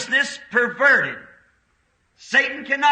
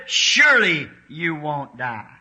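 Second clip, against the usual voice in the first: a low, sly devil's reply; low mock tempter voice; voicing the devil; 9 semitones lower than usual. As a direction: say it low like a tempter reassuring Eve. Preacher voices the devil to Eve.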